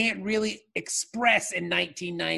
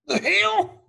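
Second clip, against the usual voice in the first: surprised; 12 semitones higher, 4 dB louder than usual. Real, not acted. Mock shock at reading.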